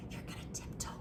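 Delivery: tiptoeing whisper; really quiet. Shown as a demonstration. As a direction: whisper it as if creeping past a sleeper. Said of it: "be really quiet at first".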